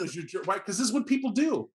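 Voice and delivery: ordinary talk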